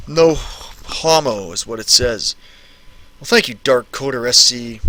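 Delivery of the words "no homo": soft, slow teasing drawl; slow drawn-out mock tone. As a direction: stretch "No homo" out slowly and softly. Repeating the chat line, drawn out.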